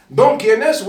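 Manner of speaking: loud appeal to the people; calling out. Real, not acted. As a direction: call out to everyone listening. Calling out to the audience.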